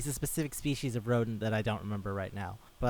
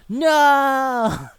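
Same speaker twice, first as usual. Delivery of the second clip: high, loud drawn-out scream; horrified scream; 12 semitones higher, 12 dB louder than usual. Retold or imitated. Lion learns the mating cycle ends soon, long loud scream. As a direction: let out a stricken no.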